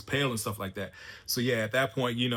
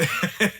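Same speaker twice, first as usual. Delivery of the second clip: high, quick burst of laughter; real chuckle; 8 semitones higher, 4 dB louder than usual. Real, not acted. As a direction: chuckle in spite of the sadness. Laughs after the sad scene.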